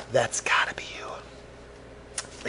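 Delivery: slow, breathy whisper; hushed movie quote. Acted. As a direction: whisper it slowly, playing a film line. Repeats the hushed movie line.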